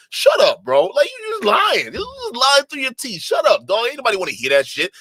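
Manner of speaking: yelling high and loud in disgust; fed-up ranting shout; angry shouting. Real, not acted. Ranting at the lying storyteller.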